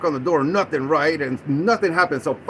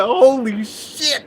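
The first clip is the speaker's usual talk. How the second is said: drawn-out, higher-pitched stunned curse; slow, raised cry of astonishment; retold shock; 6 semitones higher, 4 dB louder, much slower than usual. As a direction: stretch it out in mock alarm. Retelling sight of surrounding cops.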